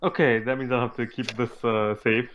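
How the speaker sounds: normal talking voice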